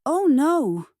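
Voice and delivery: loud, high cry of dismay; alarmed shout. A narrator's reading. Winnie sees her curtains on fire, shouted dismay. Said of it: "shouted Winnie".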